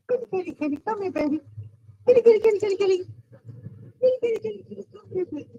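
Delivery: high, sing-song kitty call; calling a cat. Real, not acted. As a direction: coax the cat, high and sweet.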